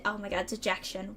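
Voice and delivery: normal talking voice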